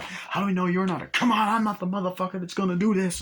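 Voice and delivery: high, mock-furious movie quarrel; acted heated argument. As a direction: act out accusation and indignant denial. Acted accusation and angry denial.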